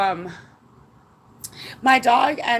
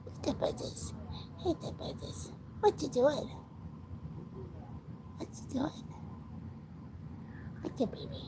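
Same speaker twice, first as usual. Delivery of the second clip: whispering; 16 dB quieter than usual. Real, not acted. Asking the baby what it's doing, whispered. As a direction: whisper sweetly to a pet. Softly talking to pet.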